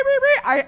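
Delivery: high mock chirp; bird chirp imitation. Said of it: "I hear a chirping bird".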